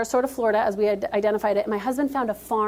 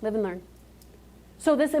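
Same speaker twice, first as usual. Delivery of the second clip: ordinary talk, nothing marked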